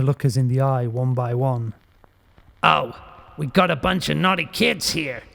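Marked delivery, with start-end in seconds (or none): 2.6-5.2 put-on higher, brash Bronx accent; a cocky Bronx tough scolding the kids. A narrator's reading. Demon doll taunting the kids who woke it, brash Bronx accent. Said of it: "Its accent was brash, like it came right out of the Bronx".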